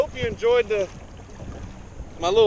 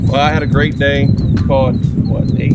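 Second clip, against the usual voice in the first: raised voice against the wind; shouting over wind; 5 semitones lower, 9 dB louder than usual. Real, not acted. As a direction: call it out loud over gusts. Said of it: "Hopefully you can hear me over the wind".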